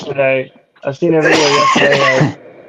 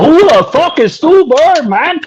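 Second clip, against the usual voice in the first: high, loud mock yell; high-pitched shouted question; yelling Chinese guy; 12 semitones higher, 5 dB louder than usual. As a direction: imitate an indignant man shouting. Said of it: "it's just a Chinese guy yelling".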